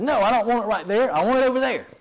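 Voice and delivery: high, loud imitated scolding; bossy, rising to a shrill demand; shrilly witchy old lady. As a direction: correct a helper like a cranky old witch. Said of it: "kind of wicked witch of the west, shrilly voice".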